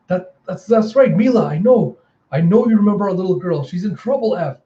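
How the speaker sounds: high, pressing acted plea; urgent pleading. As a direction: plead with a loved one who is slipping away. Pleading with Effie to remember.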